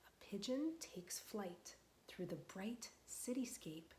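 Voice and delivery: slow, gentle picture-book reading; reading to children. Acted. Teacher reading a picture book aloud to children, slow and gentle. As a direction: read it slowly to the little ones.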